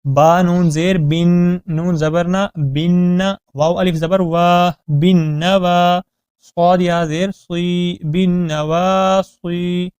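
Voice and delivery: بالنواصی built up syllable by syllable; spelling out. Shown as a demonstration. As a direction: spell it out slowly: بن نوا صی. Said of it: "بالنواصی میں با کو نون کے ساتھ ملایا جائے گا".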